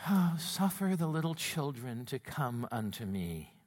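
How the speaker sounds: soft, low, sugary recitation; mock the gentle Sunday-school Jesus; pious hushed reading; gentle soft-spoken Jesus. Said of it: "we always think of Jesus as".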